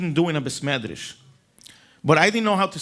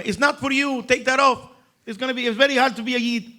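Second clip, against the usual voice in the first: scolding; 7 semitones higher than usual. Retold or imitated. Yid scolding the narrator.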